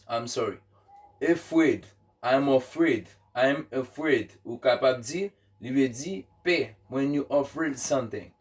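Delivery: normal talking voice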